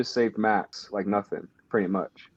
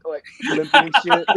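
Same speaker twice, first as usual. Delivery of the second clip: ordinary talk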